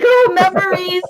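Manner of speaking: high, loud weepy gushing; mock tearful. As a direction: play it choked up with sentiment. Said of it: "I'm not tearing up".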